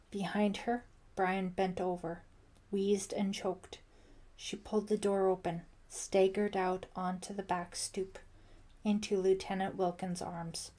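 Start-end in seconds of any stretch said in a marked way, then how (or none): none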